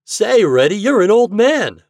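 high, loud teasing call; boyish cry. A narrator's reading. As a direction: cry it out to a pal. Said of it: "cried Toad".

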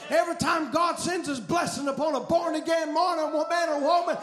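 loud, driving revival sermon delivery; impassioned preacher, rising to shouts; full-force preaching. Real, not acted. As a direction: preach it hard, stretching the big phrases.

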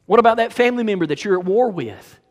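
loud, high-pitched, insistent sermon; fervent preaching. Real, not acted. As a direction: build to a forceful rebuke.

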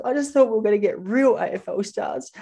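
put-on small boy's let-down, teary whine; upset little kid. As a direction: play the disappointed kid who is about to cry. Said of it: "one kid came up to me in tears".

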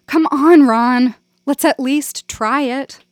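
high, loud coaxing call; coaxing plea. A narrator's reading. Janet urging Ron to try the scene, loud and pleading. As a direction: wheedle him into it.

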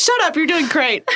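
high mock scold, then praise; imitating Trin. Quoting her friend Trin's loving put-down, high and playful. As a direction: squeak out a fond fake scolding of her friend. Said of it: "what it's like to be friends with Trin".

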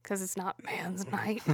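hushed, secretive whisper. Real, not acted. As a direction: lean in and whisper it. Whispered joke reply.

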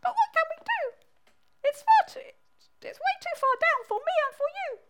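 very high squeaky bat. Acted. Bat says the hat is too far down, high squeaky voice. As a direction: fret in a shrill little squeak. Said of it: "said the bat".

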